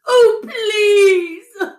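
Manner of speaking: high, crying wail; wailing plea. A narrator's reading. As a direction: beg with a sob in the voice. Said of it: "the fox wailed".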